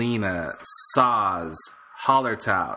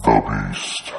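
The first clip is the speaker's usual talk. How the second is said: very low growled beast voice, louder; monster voice; 14 semitones lower, 5 dB louder than usual. Acted. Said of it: "That was awesome".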